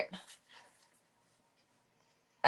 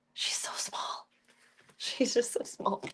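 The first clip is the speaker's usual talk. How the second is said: whispering; 2 semitones higher, 6 dB quieter than usual. Real, not acted. Whispering over tiny cat.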